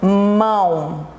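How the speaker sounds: "Mão" as one long, unbroken syllable. Shown as a demonstration. Teacher showing one mouth opening, "Mão" in one syllable. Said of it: "Eu abro a boca apenas uma vez".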